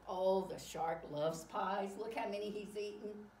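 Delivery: plain reading voice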